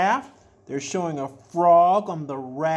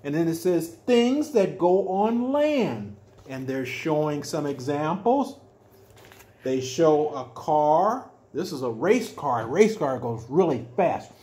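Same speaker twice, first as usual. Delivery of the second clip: ordinary delivery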